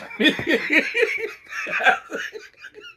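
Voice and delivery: high, breathless laughing fit; squealing with laughter. Real, not acted. Shrieking with laughter over the friends.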